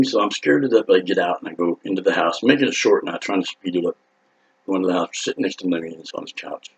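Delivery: ordinary talk, nothing marked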